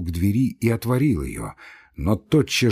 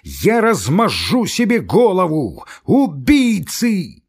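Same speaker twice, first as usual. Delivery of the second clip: despairing shout; 8 semitones higher, 5 dB louder, slower than usual. Acted. Ivan's despairing rage.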